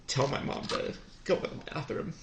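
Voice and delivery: choked up and near tears, voice going thin and high; the words barely get out through real tears; voice breaking. Real, not acted. Son telling of his dying mother, voice breaking.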